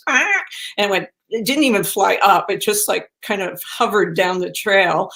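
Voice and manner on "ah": sudden high squawk of a startled grouse; startled bird squawk. Retold or imitated. Imitating the grouse underfoot, a high squawk. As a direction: yelp like a terrified bird. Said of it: "it was terrified and went like".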